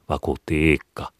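plain reading voice

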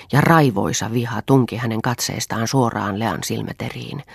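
ordinary delivery

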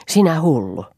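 a restrained inner cry of "Sinä hullu!"; mild mock shout. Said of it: "järkeni huusi minulle".

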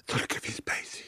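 hushed, secretive punchline; very soft storyteller's whisper; whispered reveal. Retold or imitated. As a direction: drop to a whisper for the big name.

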